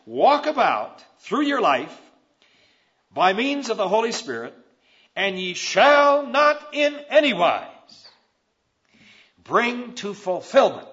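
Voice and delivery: slow, rising, loud reading of the verse; emphatic scripture reading. Acted. Preacher reading the verse to the church, slow and emphatic. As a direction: read every word as a promise.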